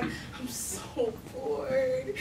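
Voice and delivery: acted-out boredom, slow and soft; bored groan. Girl stuck in the country with nothing to do, bored. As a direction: draw it out, softly and very slowly.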